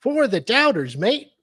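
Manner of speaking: high, lilting fake Aussie; bad Australian accent. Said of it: "You really need to work on your accents".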